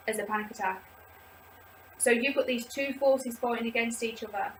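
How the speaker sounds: ordinary talk, nothing marked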